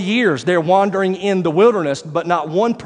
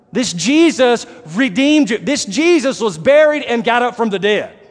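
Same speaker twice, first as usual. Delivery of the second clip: rising, emphatic preacher cadence; preaching; 5 semitones higher than usual. Acted. Preacher reminding the church who they worship, fervent preaching. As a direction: build the praise, hammer each line.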